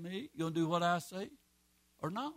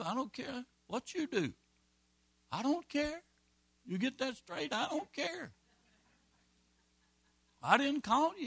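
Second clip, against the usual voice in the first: raised-pitch mock-indignant rant; retold defiant retort; 6 semitones higher than usual. Preacher retelling his retort to an offended man, high and defiant. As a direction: throw it back at the complaining man, pitched high. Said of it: "I said".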